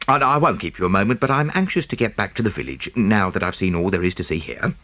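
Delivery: businesslike and polite, keen to move on; lightly voiced Jap. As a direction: Scotland Yard inspector greeting the nephew, brisk. Jap the inspector speaks.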